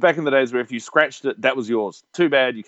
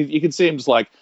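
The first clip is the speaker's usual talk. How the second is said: ordinary delivery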